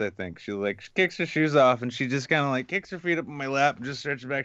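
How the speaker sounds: ordinary talk, nothing marked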